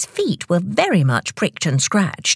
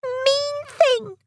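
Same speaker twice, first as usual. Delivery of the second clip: angry shouting. A narrator's reading. Tick robbed of his ices, angry high-pitched cry. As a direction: squeak it crossly. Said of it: "he shouted".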